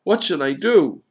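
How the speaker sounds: desperate, loud high question; high, exasperated appeal; crying out. A narrator's reading. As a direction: cry out for an answer. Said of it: "Johann cried".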